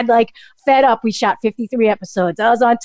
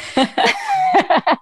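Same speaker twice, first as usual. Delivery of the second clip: a high burst of laughter. Real, not acted. Laughing high at her own cabbage joke.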